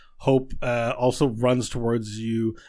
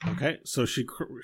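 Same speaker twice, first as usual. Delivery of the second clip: usual speaking voice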